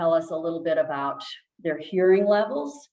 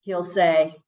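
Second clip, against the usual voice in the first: usual speaking voice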